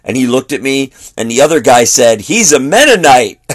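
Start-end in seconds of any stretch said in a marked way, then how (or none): 2.2-3.4 raised, louder retold accusation; mock telltale announcement, pitched up; tattling. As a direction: imitate someone ratting out a friend. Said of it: "He kinda ratted him out".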